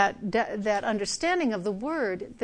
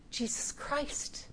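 slow, reverent whisper; hushed, slow whisper of the answer; 3 semitones higher, 11 dB quieter, slower than usual. Acted. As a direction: breathe the name slowly. Reverent answer to own question.

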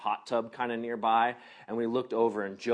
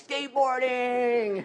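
put-on excited little boy; 10 semitones higher, slower than usual. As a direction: stretch it out with childlike glee. Excited boy's answer, retold.

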